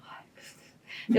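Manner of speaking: breathless unvoiced "ai, meu Deus", slow; exasperated whisper. Teen rolls his eyes at the clown, whispering. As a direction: sigh it out, fed up.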